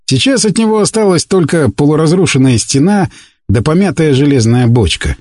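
ordinary delivery

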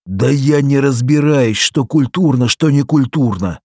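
high-pitched, self-justifying protest; acted indignant excuse, pitched well up; defensive excuse. A narrator's reading. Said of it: "оправдывался Попеленко".

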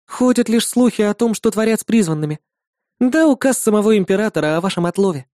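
put-on high bright girlish voice; young woman voice. A narrator's reading. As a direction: voice the demon girl cheerfully. Irda explains.

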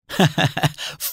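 brief breathy scoffing laugh; a quick mocking chuckle; short laugh. Nour scoffing at Gabriel's reasoning, short laugh. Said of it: "ضحك نور ضحكة قصيرة".

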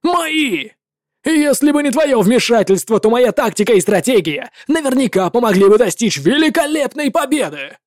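indignant commander shouting down a rival; a general who refuses to share the glory; furious legate. Acted. Legate rages at the warlock.

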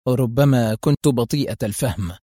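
normal talking voice

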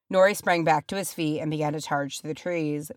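usual speaking voice

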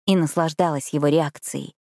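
ordinary delivery, nothing marked

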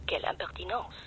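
whispered French aside, offended; scandalised whisper; indignant whisper. Acted. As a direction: breathe it out in disdain. Indignant aside under breath.

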